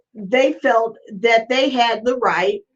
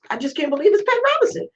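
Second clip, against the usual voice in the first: rushed disbelief; 8 semitones higher, much faster than usual. Real, not acted. Stunned by Pat Robinson clip.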